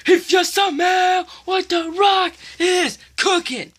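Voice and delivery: high, slow, shouted wrestler catchphrase; Rock catchphrase. Retold or imitated. Kid imitating The Rock's entrance line, slow loud shout. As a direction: bellow it slowly like The Rock on the mic. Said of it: "that was The Rock segment".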